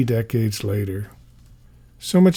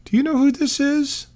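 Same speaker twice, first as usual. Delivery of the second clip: high, bright motherly question, well above his own voice; mother's voice. Retold or imitated. Mother prompting her son, voiced much higher. Mother asks child at show.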